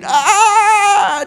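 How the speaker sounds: high drawn-out shriek; imitated scream of anguish; demonstrated shriek. Shown as a demonstration. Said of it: "Dia menjerit".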